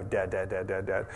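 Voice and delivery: a quiet, droning Dad on repeat; kids pestering softly. Retold or imitated. Kids nag their dad at his phone, soft and droning. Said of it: "They just go".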